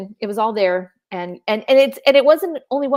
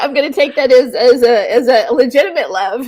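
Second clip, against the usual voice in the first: high, loud, cracking up; real giggling fit; laughing; 4 semitones higher, 7 dB louder than usual. Real, not acted. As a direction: talk through helpless laughter. Cracks up at a viewer comment.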